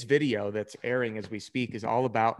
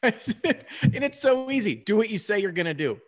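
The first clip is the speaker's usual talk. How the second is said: laughing agreement; 9 semitones higher, 5 dB louder than usual. Real, not acted. Host agreeing with guest about the easy win, laughing. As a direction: laugh through it, high and loud.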